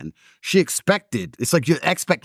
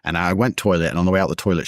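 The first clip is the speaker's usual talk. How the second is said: ordinary talk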